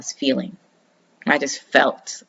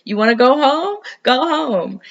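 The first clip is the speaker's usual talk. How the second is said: pitched way up, sing-song invitation; high playful call; 10 semitones higher than usual. Retold or imitated. Moon inviting her home, high sing-song call. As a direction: call it out high and light, like a welcome. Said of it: "It's like".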